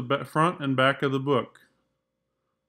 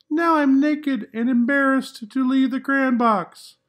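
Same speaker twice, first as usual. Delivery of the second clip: higher, pleading crayon; a hint of embarrassed whine; embarrassed Peach Crayon; 12 semitones higher, 10 dB louder than usual. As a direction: plead like a bashful naked crayon.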